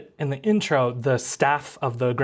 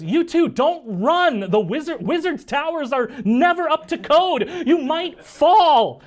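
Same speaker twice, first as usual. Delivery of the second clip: put-on shrieked girlish scolding, building louder to the end; calling down the hall; 12 semitones higher, 5 dB louder than usual. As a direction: holler after running friends from afar. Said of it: "you hear her before, uh, you see her".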